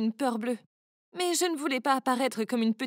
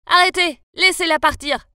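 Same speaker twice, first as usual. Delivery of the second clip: loud commanding shout; 3 semitones higher, 10 dB louder than usual. Acted. Kurt shouts from behind at the attackers, loud and commanding. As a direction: bellow at them to stop. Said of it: "une voix derrière moi qui criait".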